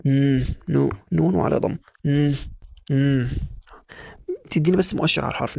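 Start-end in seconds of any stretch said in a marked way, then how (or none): 2.0-3.3 مم as a short held nasal hum for N; closed-mouth N. Shown as a demonstration. Teacher showing N resembles M, closed-mouth hum. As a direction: hum مم without opening the mouth. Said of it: "ما بتفتحش بقك خالص".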